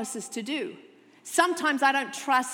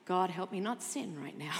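muttered prayer; 5 semitones lower, 6 dB quieter than usual. Retold or imitated. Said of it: "I bet he looked at them and thought".

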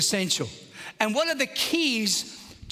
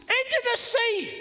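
mock command. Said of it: "I looked at the Blue Mountains and I said".

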